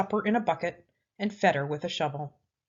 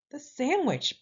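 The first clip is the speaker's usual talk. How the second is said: begging; 7 semitones higher than usual. A narrator's reading. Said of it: "begged Pinkerton".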